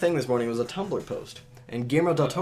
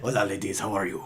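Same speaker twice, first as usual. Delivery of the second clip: Guillermo del Toro; 5 semitones lower than usual. Retold or imitated. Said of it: "with a really thick Spanish accent".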